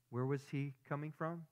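ordinary talk, nothing marked

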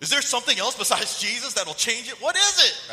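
loud, high and building to a yell; incredulous questioning that peaks at the end; baffled demand. Retold or imitated. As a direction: demand an answer in mock bewilderment. Retold baffled demand for explanation.

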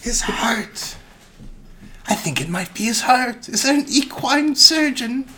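a fussy lady panicking over her horse; mock-worried lady. Owner fretting to the farrier about her horse, high mock-worry.

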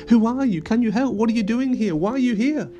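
high, eager string of questions; curious fairies. A narrator's reading. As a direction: pipe up like inquisitive little fairies. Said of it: "bolder and more curious fairies".

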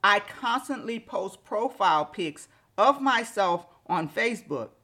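normal talking voice

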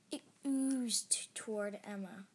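plain reading voice

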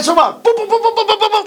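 mock gunshot booms, rising high; gunfire noises. Imitating gunfire while telling friend. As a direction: boom out the shots like a cartoon.

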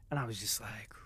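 hushed whispered aside. Real, not acted. Vlogger leaning in to gossip, whispered aside. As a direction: drop into a whisper. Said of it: "whispered aside".

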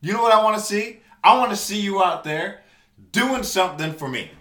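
loud, angry outburst; heated yelling. Real, not acted. Yelling at topless celebrities, angry shout. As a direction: blow up at them, furious.

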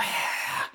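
voiceless goose hiss; imitated goose hiss. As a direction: hiss like an angry Canada goose. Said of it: "The way they hiss at you".

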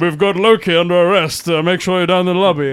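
lightly voiced stern hero; a hint of a stiff, humorless captain; Captain America. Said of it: "the impression of, of, um, Captain America".

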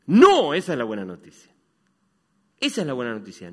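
booming good news; loud proclamation. Acted. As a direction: proclaim it to the whole church. Predicador proclama la buena noticia. (proclamar a viva voz)

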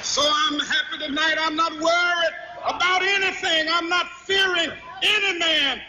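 high, ringing sermon climax; impassioned, exultant proclamation building to a shout; full-force preaching. Real, not acted. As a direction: cry it out to the roaring congregation. Sermon climax to cheering congregation.

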